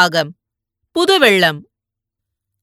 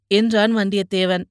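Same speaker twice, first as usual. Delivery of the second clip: usual speaking voice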